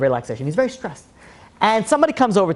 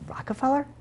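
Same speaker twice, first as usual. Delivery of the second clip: mild disbelief; 7 semitones higher, 9 dB quieter than usual. Retold or imitated. Acted man reading the check.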